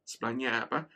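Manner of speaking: normal talking voice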